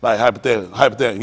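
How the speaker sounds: ordinary talk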